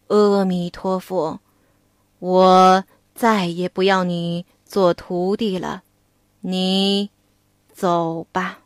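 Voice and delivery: a grieving master sending his pupil away; Tang Seng's slow, heavy farewell; sorrowful old monk. Acted. Tang Seng dismisses Wukong.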